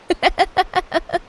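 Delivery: sudden high laugh at the name; burst of laughter. Acted. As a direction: crack up at a terrible idea. Listener suggests an awful name.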